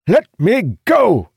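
high, loud, drawn-out yell; furious shout. A narrator's reading. Captain seized by soldiers, shouting. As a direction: shout it out with all your force. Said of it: "he shouted".

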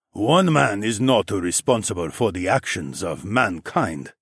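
put-on slow, deliberate accented character voice; solemn pronouncement. Underground king concedes the alien is blameless, solemn. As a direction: pronounce it slowly and weightily. Quan solemnly echoes the narrator.

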